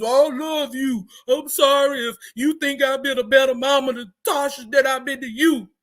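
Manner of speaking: a touch of a tearful mama's apology; mock tearful mama. Mother clutching her daughter's face, mock tearful pleading. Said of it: "her mama grabs her face and says".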